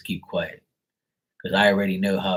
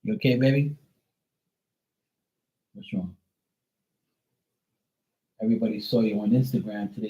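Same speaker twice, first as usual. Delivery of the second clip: talking to the dog. Real, not acted.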